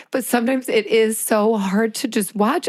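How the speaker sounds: usual speaking voice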